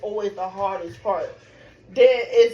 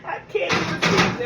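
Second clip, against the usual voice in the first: retelling her labor despair; 10 semitones higher than usual. Retold or imitated. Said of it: "and I'm like".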